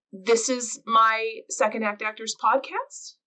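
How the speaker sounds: statement lifted into a question at "podcast"; slow line, rising at the end on "podcast"; upspeak. Shown as a demonstration. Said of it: "like, upspeak".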